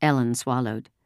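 plain reading voice

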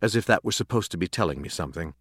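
plain reading voice

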